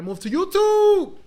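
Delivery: loud, sing-out rallying cry; loud playful exclamation. Real, not acted. Host rallies followers to switch platforms, loud exclamation. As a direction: belt out YouTube, drawn out high.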